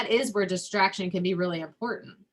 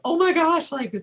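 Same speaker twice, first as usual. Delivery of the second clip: high, loud frantic worry, much higher pitch; panicked parent; 9 semitones higher, 5 dB louder than usual. Retold or imitated. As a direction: voice a parent spiralling in fear at her child. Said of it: "if my kid acts out and I go".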